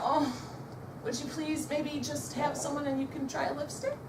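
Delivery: soft, hesitant mock request; timid plea. Nervous consultant begs a guest to book, timid and soft. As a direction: ask meekly, as if expecting a no.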